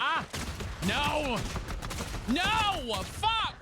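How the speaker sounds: real gamer rage, shouting; furious yell. Real, not acted. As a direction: yell at the screen as you lose. Dying in the game.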